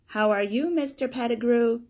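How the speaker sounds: high, loud greeting; children greeting cheerily. Acted. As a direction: call out like children to a grown-up.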